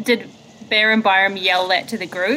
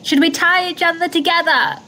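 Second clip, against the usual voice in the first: raised-pitch acted call; pitched-up pretend yell; calling over wind; 6 semitones higher than usual. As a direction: make yourself heard through the storm. In character, yelling through the storm.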